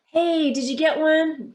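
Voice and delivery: bright eager schoolkid question; curious kid. Acted.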